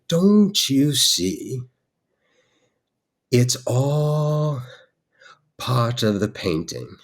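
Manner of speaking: slow, drawn-out wizardly pronouncement; masterful wizard. Retold or imitated. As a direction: intone it like a wizard casting a spell. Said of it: "like he was a masterful wizard of some kind".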